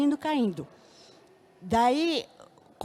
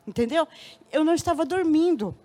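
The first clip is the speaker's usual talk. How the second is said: ordinary delivery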